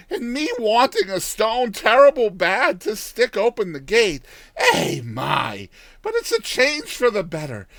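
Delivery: put-on high, loud old village woman; old countrywoman. Old woman pleased with stone.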